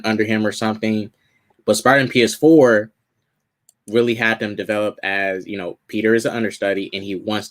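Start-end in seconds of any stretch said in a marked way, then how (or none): none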